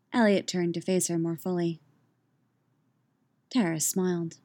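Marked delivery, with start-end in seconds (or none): none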